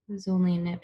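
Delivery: ordinary delivery, nothing marked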